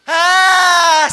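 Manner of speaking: loud, long, high yell; imitated child's yell. Preacher mimics children yelling at their parents, loud drawn-out yell. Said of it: "hijos que gritan a los papás".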